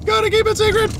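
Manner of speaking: squeaky high giggling line; high giggle. Real, not acted. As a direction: giggle it, conspiratorial. Giggle about secrecy.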